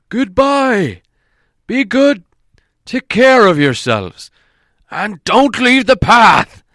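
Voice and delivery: calling from afar. A narrator's reading. Gandalf riding off, calling back to the dwarves from far away. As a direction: call back across the distance, hands cupped. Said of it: "called to them".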